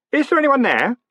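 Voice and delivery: high, loud uncertain call; nervous call. A narrator's reading. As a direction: call out into the darkness. Calling into a dark hall.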